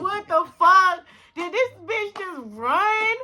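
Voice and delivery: high, loud outburst stretching into slow disbelief; shocked disbelief. Retold or imitated. Reacting to the girl bolting, loudly incredulous. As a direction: relive it as stunned outrage.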